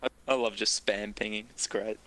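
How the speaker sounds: normal talking voice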